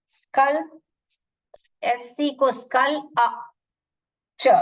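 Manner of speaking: स्कल, then च, in separate pieces; sound-by-sound pronunciation. Shown as a demonstration. Teacher breaking the word down for pupils, piece by piece. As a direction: sound out स्कल and च with gaps between. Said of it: "एल का sound".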